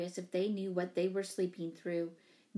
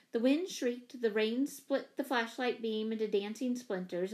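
usual speaking voice

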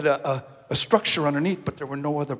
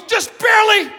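higher, louder shaken answer; shouted reply; 11 dB louder than usual. Retold or imitated. Worker shouting back to the pastor, shaken loud reply. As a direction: call back, barely holding on.

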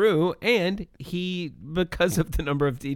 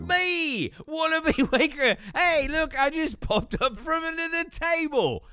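put-on shitty Australian accent. Wallaby Wanker greeting podcast viewers in a hammed-up Australian accent. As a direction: play a cheesy Aussie safety mascot. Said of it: "your shitty Australian accent".

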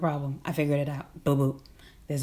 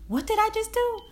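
much higher, squeaky stunned question; shocked squeak. Retold or imitated. Recalling the moment after voting, a high shocked squeak. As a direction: squeal it in disbelief.

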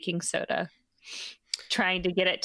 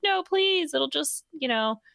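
much higher, insistent mock pleading; high hammed-up coaxing; pleading sweet wife; 7 semitones higher than usual. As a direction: beg them sweetly to eat. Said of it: "And she's like".

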